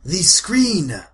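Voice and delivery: high, loud, slow proclamation; hammed-up reverent elder praising a star; village matriarch. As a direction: proclaim it grandly in halting phrases. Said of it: "and then she says".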